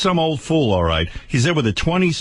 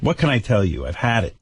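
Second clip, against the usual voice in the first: ordinary talk, nothing marked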